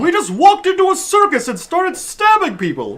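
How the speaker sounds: exasperated scolding. Acted. Player to party after stabbing clowns, exasperated scolding. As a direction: berate them, pitch jumping up on key words.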